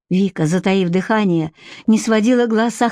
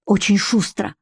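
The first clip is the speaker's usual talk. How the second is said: ordinary delivery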